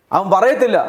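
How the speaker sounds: usual speaking voice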